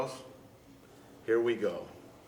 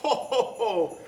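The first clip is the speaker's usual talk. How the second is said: big jolly ho ho ho, high and hearty; Santa laugh; 6 semitones higher than usual. Acted. Santa greeting boys and girls with a jolly laugh.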